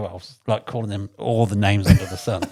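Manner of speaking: normal talking voice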